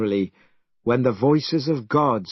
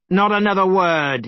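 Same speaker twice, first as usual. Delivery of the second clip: angry cry; 7 semitones higher, 4 dB louder than usual. Acted. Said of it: "he cried".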